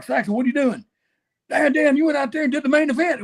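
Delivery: hammed-up lecture from the old guys; mock scolding. Veterans scolding him backstage.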